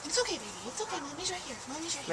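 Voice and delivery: soft reassuring whisper-like coo; soothing scared chickens. Real, not acted. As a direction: comfort the scared hen like a mother. Soothing a frightened hen.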